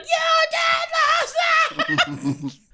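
very high, slow squealing cheer; cheering audience woman. Retold or imitated. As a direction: squeal it out like a thrilled fan.

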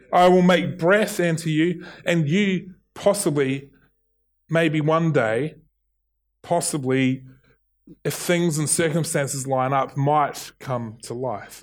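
confident start trailing into hesitant maybes; tentative mock promise, fading away; hedged, noncommittal promise. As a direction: hedge with long pauses on POSSIBLY and MAYBE, sinking at the end. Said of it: "No, he says".

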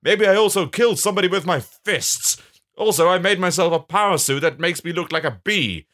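high, hammed-up villain boasting; bad man. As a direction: proclaim each deed grandly. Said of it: "the bad man".

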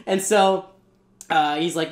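usual speaking voice

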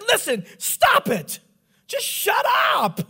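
high, slow mock shouting; hammed-up yell to silence someone; exasperated. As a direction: draw out each command, high and fed up. Retells snapping back at patient.

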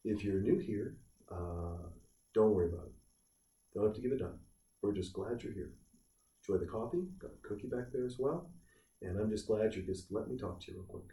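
hushed, low, halting mock pastor; very soft, low murmur with hesitant pauses; soft-spoken pastor. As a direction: play a meek pastor easing gently into the ask. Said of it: "when you hear pastors talk about it".

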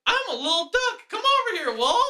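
high cartoon duck voice, way up; sing-song little duck calling the wolf; squeaky duck voice. Ad jingle duck voice again.

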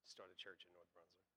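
very soft, low voice; quietly voiced inner call; still small voice. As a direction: say it hushed and low, like an inner prompting. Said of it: "a still small voice say".

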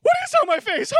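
very high, loud panicked question; panicked shriek. Retold or imitated. Husband panicking at wife's reaction, shrieking. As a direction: squeal it in alarm. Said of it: "I go".

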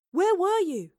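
high, loud eager question; boy demanding to know where Robert went. A narrator's reading. Boy asks absent Robert.

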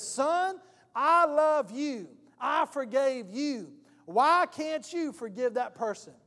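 God addressing a son, slow and emphatic; fatherly appeal. Acted. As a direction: press each phrase slowly onto the listener. God's voice convicting a son.

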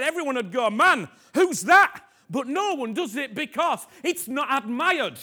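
loud, high, passionate preaching; loud high sermon cry; fervent shout. Real, not acted. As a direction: preach at full force. Fiery preaching.

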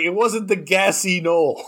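mock Boston accent. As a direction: lean on a lazy Boston vowel. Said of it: "They're like".

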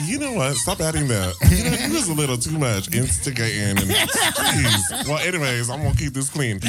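talking through laughter; laughing while talking. Real, not acted. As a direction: laugh as you say it. Laughing at the teasing.

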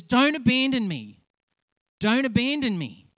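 frightened friend pleading at full pitch; desperate pleading. Retold or imitated. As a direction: cry out not to be abandoned. Friend pleading during deliverance.

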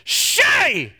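a mock yell across the room; called out loud. Friend in the balcony calls out the pastor's name, mock yell. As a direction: call the name out across a barn. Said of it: "at the top of his lungs".

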